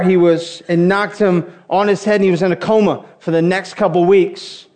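ordinary talk

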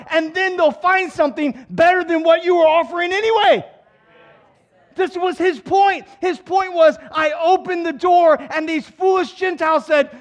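high, loud, driving sermon delivery; preaching at full force. Real, not acted.

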